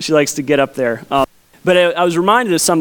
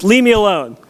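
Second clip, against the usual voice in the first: a light imitation of a toddler yelling, a bit higher; mock child yell; 4 semitones higher than usual. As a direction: play the grumpy little girl, lightly. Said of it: "she yells at me".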